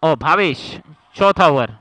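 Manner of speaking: normal talking voice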